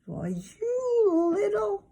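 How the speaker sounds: high, slow strangled outburst; exasperated. A narrator's reading. Big brother fuming at toddler, strangled high outburst. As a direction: choke on the anger. Peter angry at Fudge.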